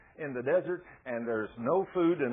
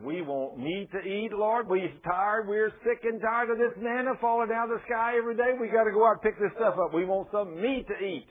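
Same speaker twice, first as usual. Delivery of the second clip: mock whining; 6 semitones higher, 4 dB louder than usual. Israelites grumbling for meat.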